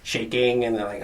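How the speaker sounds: ordinary delivery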